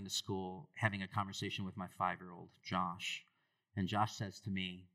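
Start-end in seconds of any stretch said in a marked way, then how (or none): none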